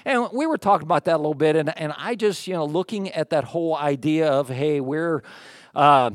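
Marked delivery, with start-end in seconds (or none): none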